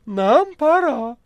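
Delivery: high, loud, slow cat voice. Acted. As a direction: stretch it out like a grand exit. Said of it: "сказал кот".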